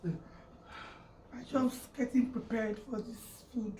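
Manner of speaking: slow, soft, through a yawn. Real, not acted. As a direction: talk mid-yawn, drowsy. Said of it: "Are you yawning?".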